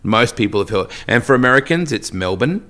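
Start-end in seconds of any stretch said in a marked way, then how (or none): none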